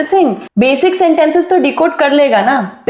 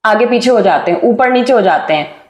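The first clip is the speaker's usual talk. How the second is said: usual speaking voice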